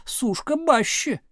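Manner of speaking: high, drawn-out heckle; heckling call. A narrator's reading. Back-row pupils mocking teacher's name, drawn-out call. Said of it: "крикнули с задних парт".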